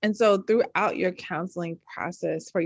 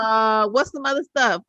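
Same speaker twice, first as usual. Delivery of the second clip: mock seeker; 4 semitones higher, 6 dB louder than usual. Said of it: "you be like".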